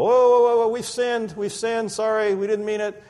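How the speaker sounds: high, frantic whoa, then fading into an apology; panicked people. Retold or imitated. Israelites begging after the snakebites, high and frantic. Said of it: "And then the people go".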